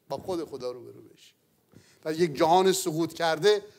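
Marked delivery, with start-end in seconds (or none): 0.1-1.3 soft echo. Shown as a demonstration.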